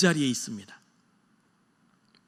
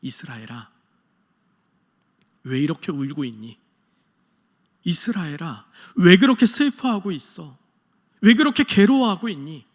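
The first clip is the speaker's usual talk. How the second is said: God's sorrowful plea; 6 dB louder than usual. Retold or imitated. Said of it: "하나님의 애잔한 마음".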